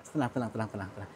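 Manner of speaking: soothing words fading to near silence; calming the spirit, trailing off quietly; fading hush. Real, not acted. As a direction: let it die away softly.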